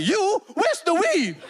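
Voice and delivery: hammed-up outrage; mock indignant. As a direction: pounce on the switch.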